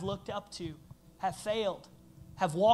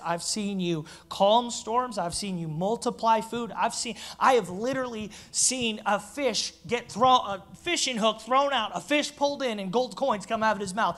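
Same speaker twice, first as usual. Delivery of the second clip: Peter reasoning; 8 dB louder than usual. Pretend. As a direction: argue with Jesus, rising to outrage. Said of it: "If I'm Peter, I'm like".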